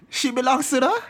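catchphrase. Acted. Catchphrase sung out high and slow.

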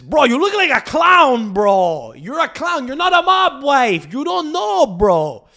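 high, loud mocking rant; Montrealse kritiek. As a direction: berate them like an indignant Montrealer. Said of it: "people in Montreal can criticize this".

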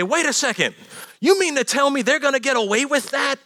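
put-on a younger child protesting unfair treatment; complaining kid. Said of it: "our kids would walk up to us and say".

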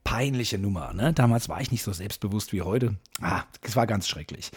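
usual speaking voice

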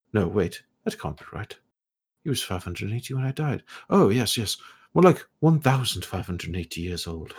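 put-on high, airy voice of a curious old elf scholar; scholarly elf ghost. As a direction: play the puzzled, polite ghost scholar, pitched high. Ghost about the bones.

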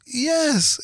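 high, melting lady's consent; touched, imitated. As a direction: imitate someone delighted by a child's request. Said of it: "And she goes".

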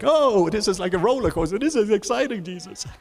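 high, thrilled squeal of delight; like kids on a roller coaster; excited kids. Retold or imitated. Kids in the boat telling Jesus the storm is fun, thrilled. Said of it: "kids was like".

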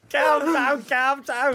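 high-pitched mock Scouse accent; Harry Enfield's Scousers. Joining in teasing the impression, in mock Scouse accent. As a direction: chime in with hammed-up high Scouse. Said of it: "Harry Enfield from the Scousers".

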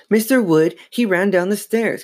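high-pitched, urgent explaining; frantic. A narrator's reading. Girl explaining to parents about the dummy, frantic. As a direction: blurt it out in a panic. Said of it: "Chris said frantically".

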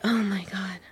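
breathy whisper under the breath, exasperated. Retold or imitated. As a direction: whisper it to yourself, fed up. Annoyed at slow lady in security.

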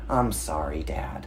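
low, soft imitation of a sorry child; melting apology. Toddler giving in to dad, soft imitated apology. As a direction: drop low and quiet, giving in. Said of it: "she'll melt and just say".

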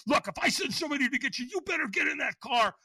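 high, loud scolding smoker lady; tough-love yell. Retold or imitated. The AA phone lady yells at him to get sober. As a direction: read him the riot act, shouting. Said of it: "She was like".

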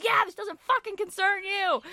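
high, loud mock outburst at the owner; imitated yelling. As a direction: act out an indignant cousin piling on. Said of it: "he starts yelling at the owner".

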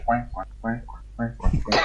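cuac, cuac imitando a un pato; graznidos de pato. Acted. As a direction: graznar como un pato que pasa bamboleándose. (imitación de pato)

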